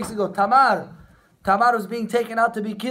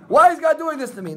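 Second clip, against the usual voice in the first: high, louder self-pitying cry to the sky; bitter complaint; 7 semitones higher, 5 dB louder than usual. Acted. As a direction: whine at heaven, feeling wronged. Voicing the wrong, bitter reaction.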